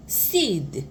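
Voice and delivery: usual speaking voice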